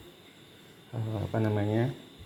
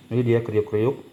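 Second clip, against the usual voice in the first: talking through a crunchy mouthful; crunching while eating; 2 semitones higher, 3 dB louder than usual. Real, not acted. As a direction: chew and speak between bites. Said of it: "Kedenger ya". (bicara sambil mengunyah renyah)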